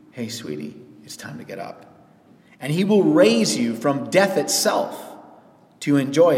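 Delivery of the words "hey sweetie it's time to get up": lower, fading to a whisper; a loving whisper to wake someone; tender wake-up call. Retold or imitated. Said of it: "and he will say to you".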